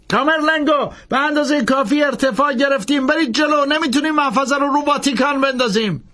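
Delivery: high, pressing plea, slowing on the key words; desperate Langdon pleading. A narrator's reading. Langdon begging the priest to take off, high desperate plea. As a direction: beg him to just fly.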